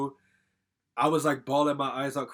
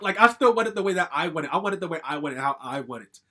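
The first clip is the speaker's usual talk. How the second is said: bratty insistence; 3 semitones higher, 7 dB louder than usual. Retold or imitated. Spoiled brat tantrum retold.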